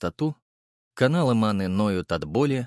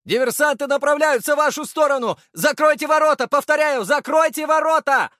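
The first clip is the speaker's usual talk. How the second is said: high urgent yelled warning; shouting; 6 dB louder than usual. A narrator's reading. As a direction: call it out across the yard. Soldier calling warning.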